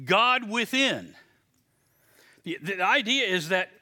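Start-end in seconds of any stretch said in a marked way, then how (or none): 0.1-1.1 God within, slow and weighty; emphatic reveal. Shown as a demonstration. Preacher revealing the Greek meaning, slow and emphatic. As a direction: land it slowly. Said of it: "you say".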